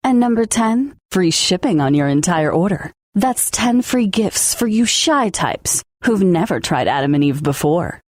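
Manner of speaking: ordinary talk, nothing marked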